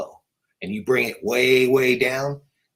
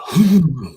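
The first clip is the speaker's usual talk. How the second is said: forceful whispered "hoo"; breathy whisper imitation; 5 semitones higher, 8 dB louder than usual. Researcher imitating a recorded sound with power behind it, breathy whisper. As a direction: push out one strong, breathy whispered call. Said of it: "behind that whisper".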